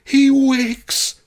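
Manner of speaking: high, piercing shriek; shrieking excited crowd. A narrator's reading. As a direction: shriek it at the top of your lungs. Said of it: "they shrieked at the tops of their lungs".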